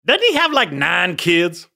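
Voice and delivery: high, loud imitation of her incredulous jab; sassy mock disbelief, starting way up high; sassy retort. Woman sassily shooting down a wingman's pitch, high and loud. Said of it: "sassy line from her".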